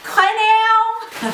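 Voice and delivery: very high, slow, stretched-out exclamation; theatrical gushing. Real, not acted. Showing off the vase to viewers, high theatrical gush. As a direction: squeal it slowly, drawing out each vowel.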